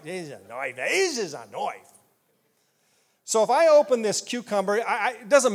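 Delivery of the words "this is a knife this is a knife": low Aussie movie-hero voice; Crocodile Dundee impression. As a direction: hold up the knife like Crocodile Dundee. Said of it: "Crocodile Dundee".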